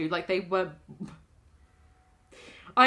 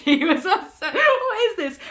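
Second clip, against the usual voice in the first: cracking up, high and loud; can barely get the words out for laughing; 8 semitones higher, 6 dB louder than usual. Real, not acted. Breaks up at own accidental accent.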